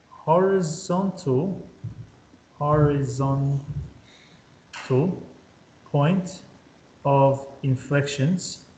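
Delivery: slow dictation. Shown as a demonstration. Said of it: "a note that you've got to write".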